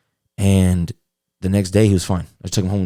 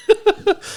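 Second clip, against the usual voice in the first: burst of laughter. Real, not acted. Laughing at his high dog.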